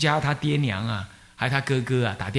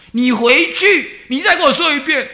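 retold rebuke; 12 semitones higher, 10 dB louder than usual. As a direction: dare him to say it again, retelling the scolding. Teacher rebuking student who quits.

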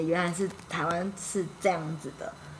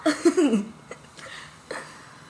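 laughter; 9 semitones higher, 3 dB louder than usual. Real, not acted. Bursts out laughing.

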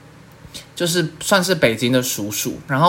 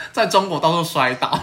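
normal talking voice

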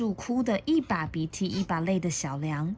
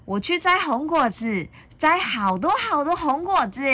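put-on high, cheerful child voice; gleeful, lifted storybook youngster; happy little dinosaur; 9 semitones higher, 4 dB louder than usual. A narrator's reading. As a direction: announce it with a smile. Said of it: "露出了笑容".

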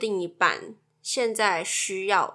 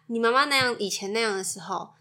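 ordinary talk

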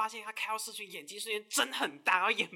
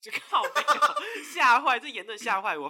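loud, laughing shock; a loud, laughing "oh my god" reaction; shocked outburst; 5 semitones higher, 11 dB louder, slower than usual. Real, not acted. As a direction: blurt it out, scandalized and laughing. Shocked reaction to dirty joke.

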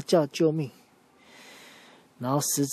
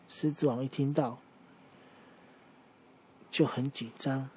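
ordinary delivery

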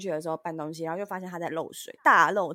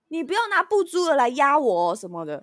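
higher, much louder imitated scolding; fierce scolding; 7 semitones higher, 10 dB louder than usual. Imitating the landlady scolding her tenant fiercely. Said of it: "阿姨突然超凶的看我说".

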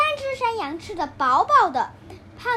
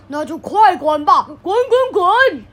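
loud troll command; 8 dB louder than usual. A narrator's reading. Troll yells at the second goat to get lost, loud. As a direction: chase him off with each 滚.

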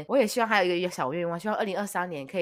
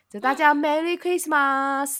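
slow, heavy Japanese-style Merry Christmas; Merry Christmas 的马斯发得很重; Japanese-accented English; 9 semitones higher, 5 dB louder, slower than usual. Retold or imitated. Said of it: "马斯就发的音发的很重". (日本腔的英文)

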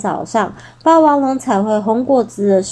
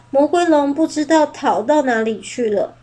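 plain reading voice

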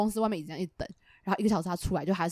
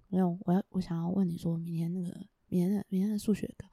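soft, low, hurried mumble; imitating a quiet mumble; 5 semitones lower, 7 dB quieter than usual. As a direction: mumble it so it barely carries. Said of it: "他讲话非常小声".

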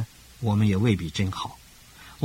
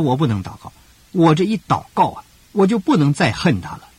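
imitating the sister's protest, higher and louder; agitated protest; 7 semitones higher, 6 dB louder than usual. A sister to her pastor, refusing to pray, in an agitated higher, louder protest.

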